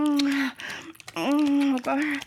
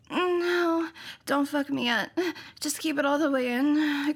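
usual speaking voice

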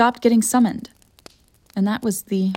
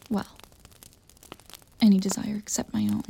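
wistful whispered aside; 8 dB quieter than usual. Acted. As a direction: breathe it out as a confession.

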